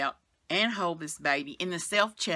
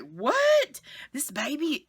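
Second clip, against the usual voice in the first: much higher, loud retold disbelief; shocked what; 9 semitones higher, 5 dB louder, much slower than usual. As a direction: yelp it in sudden disbelief. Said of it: "And I was like".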